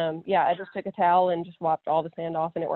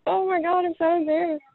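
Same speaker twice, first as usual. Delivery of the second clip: mortified mom; 10 semitones higher than usual. Retold or imitated.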